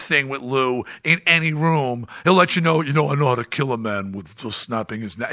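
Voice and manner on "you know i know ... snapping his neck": low, soft muscleman voice, a loose Arnold imitation; half Arnold half deaf mute. Impersonating Lou boasting he can kill, low half-Arnold voice. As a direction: lightly do a tough guy bragging he can kill. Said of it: "I don't know what voice that was. Half Arnold, half deaf mute".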